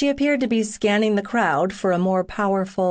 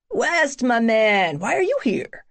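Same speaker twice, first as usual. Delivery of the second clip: excited greeting; 4 semitones higher than usual. Acted. Buddy hails him across room.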